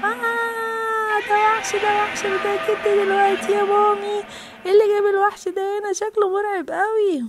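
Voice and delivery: high, drawn-out wail of fright; frightened child. Acted. Child sees the snow monster, frightened. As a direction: cry out slowly, pitched high, in alarm.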